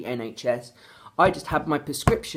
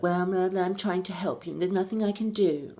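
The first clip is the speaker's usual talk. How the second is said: higher, dismissive imitation of the receptionist; mock receptionist voice; 4 semitones higher, 3 dB quieter than usual. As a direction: mimic her brush-off, higher and prissy. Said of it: "she's like".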